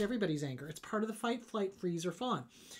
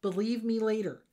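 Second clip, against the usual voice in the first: ordinary delivery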